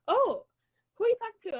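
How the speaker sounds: high mock mom voice asking who's there; playfully imitating her curious mom. Imitating her mom asking who she's talking to, high and loud. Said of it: "she was like".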